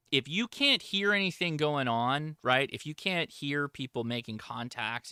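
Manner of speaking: normal talking voice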